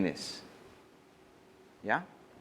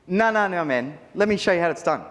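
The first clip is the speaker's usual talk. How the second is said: higher, louder botched tease at the groom; clumsy teenage copy; 6 semitones higher, 4 dB louder than usual. Retold or imitated. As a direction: overeager kid faking confidence. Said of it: "It didn't sound good coming from my mouth".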